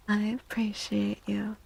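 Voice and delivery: ordinary talk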